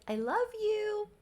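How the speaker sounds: very high, slow cooing imitation; mock sweet-talk. Boyfriend making up with girlfriend after a quarrel, high mock-sweet. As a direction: draw it out high, hamming up the sweetness.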